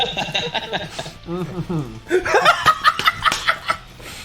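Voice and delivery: high long laughing fit. Real, not acted. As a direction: keep laughing helplessly.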